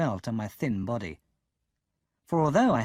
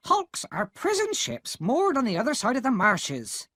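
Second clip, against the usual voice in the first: higher, curt impatient explaining; 11 semitones higher than usual. A narrator's reading. Sister explaining to Pip, impatient. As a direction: explain it with no patience. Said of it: "explained impatiently".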